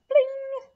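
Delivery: high loud comic bling; a high loud cartoon sound effect. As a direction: pop the sound out big and bright. Sound effect of frog landing.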